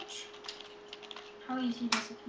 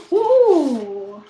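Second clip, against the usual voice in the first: excited ooh; 2 semitones higher, 14 dB louder than usual. Real, not acted. Kid sees new controller.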